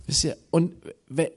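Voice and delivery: ordinary talk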